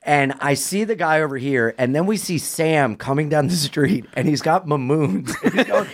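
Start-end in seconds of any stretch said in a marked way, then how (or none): none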